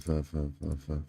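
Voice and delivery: ordinary talk, nothing marked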